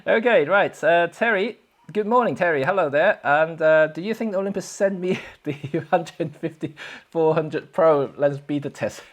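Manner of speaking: ordinary talk, nothing marked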